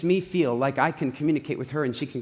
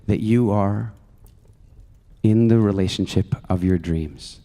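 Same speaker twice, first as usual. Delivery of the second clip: guided meditation; 5 semitones lower, 3 dB louder than usual. Acted. Speaker guiding the audience into a future vision, calm meditation voice. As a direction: ease the room into relaxation.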